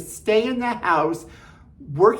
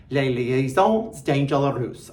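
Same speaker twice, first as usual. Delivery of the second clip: Les Liaisons Dangereuses said slowly in a French accent; 4 semitones lower, slower than usual. Shown as a demonstration. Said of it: "I said it kind of with a great accent".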